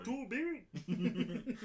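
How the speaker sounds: high, slow, laughing repeat; echoing it while laughing. Real, not acted. Laughing echo of the joke.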